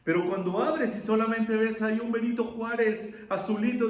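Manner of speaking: ordinary talk, nothing marked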